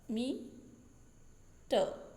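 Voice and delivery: "मीटर" drawn out as मी, long pause, टर; split into syllables. Shown as a demonstration. Teacher repeating "मीटर" for students, two syllables far apart. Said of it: "इसमें दो syllable है".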